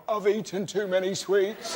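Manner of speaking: slowed, pitiful drawn-out admission; slow pathetic confession. Retold or imitated. Comic confessing to a kind old lady, slow and pitiful. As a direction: drag it out like a pathetic child.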